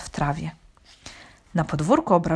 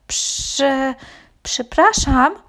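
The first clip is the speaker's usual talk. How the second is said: high, hesitant, halting apology; small, tentative high voice; timid little voice; 7 semitones higher, 4 dB louder, much slower than usual. A narrator's reading. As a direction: stammer shyly to a stranger. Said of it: "cichutko odezwał się Reksio".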